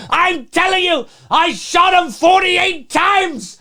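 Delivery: high, slow, shouted crazed gunman; hammed-up Loomis. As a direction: yell it like a madman emptying his gun. Acting Loomis shooting Michael.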